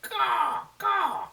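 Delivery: put-on high, loud caw for the kids; crow call demo. Shown as a demonstration. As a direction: show the children how a crow calls. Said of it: "Can you say caw, caw with me?".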